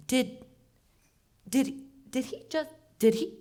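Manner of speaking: high, sputtering shock, repeating the words; can't believe what she heard; stammering disbelief. Retold or imitated. Stunned by creep's offer.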